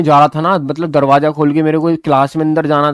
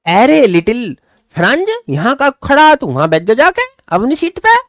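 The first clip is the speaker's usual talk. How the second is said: higher, kind, coaxing teacher voice; kindly teacher; 8 semitones higher than usual. Retold or imitated. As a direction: gently coax the late boy to his seat. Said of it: "और बड़े प्यार से बोला कि". (बड़े प्यार से, ऊँची आवाज़ में पुचकारते हुए टीचर)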